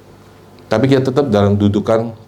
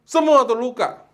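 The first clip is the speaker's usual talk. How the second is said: suara tinggi, berkhotbah penuh semangat; khotbah berapi-api. Acted. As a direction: berseru kepada jemaat dengan nada tinggi. Preaching at full force. (pendeta berkhotbah kepada jemaat, berapi-api)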